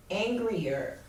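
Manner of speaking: normal talking voice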